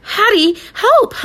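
very high, loud cry for help; stuck dog calling his girl; panicked bark. A narrator's reading. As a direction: call out in panic. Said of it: "I bark".

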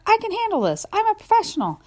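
high, loud brag; bold shout. A narrator's reading. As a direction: boast loudly, all bravado. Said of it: "I bark".

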